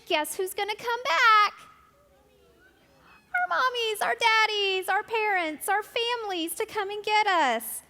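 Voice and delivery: high, loud and eager; excited. Acted. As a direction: build the thrill for kids. Building excitement for children.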